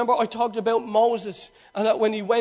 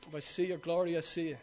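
low, quiet, take-it-or-leave-it delivery; nonchalant; 7 semitones lower, 13 dB quieter, faster than usual. Shown as a demonstration. Preacher mimics an uncaring Moses before God, nonchalant. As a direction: mumble it low and indifferent. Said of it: "You can be nonchalant".

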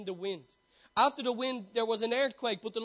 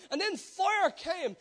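higher and slow, half-shouted; booming proclamation; 6 semitones higher, slower than usual. Acted. Preacher describing fire on the mountain, booming. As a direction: let the fire burst out. Preacher building the storm scene.

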